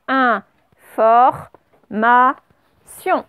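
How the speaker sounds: Information said very slowly, each syllable apart; dictating each syllable apart. Shown as a demonstration. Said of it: "On fait bien quatre syllabes l'une après l'autre".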